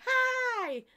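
a sudden very high, loud "Hi"; high squeal. Pretend. Greeting her viewers with a sudden high squeal. Playful sung high hello.

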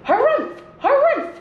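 high, loud, huffy bird cries; bird's harumph. A narrator's reading. Bongo bird furious at the squirrels, loud high harumphs. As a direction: blurt each harumph like an outraged bird. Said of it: "yelled the bongo bird".

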